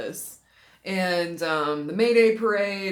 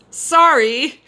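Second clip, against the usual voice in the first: higher, louder sorry; mock apologetic note; 8 semitones higher, 13 dB louder than usual. Reading the drunk note's apology to viewers, higher and louder. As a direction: read the note's apology big.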